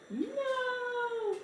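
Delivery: high, sharp dismayed no; a sudden real yelp of dismay; dismayed cry. Real, not acted. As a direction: cry out as the iron scorches it. Burns the fabric.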